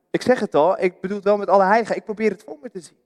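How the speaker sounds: normal talking voice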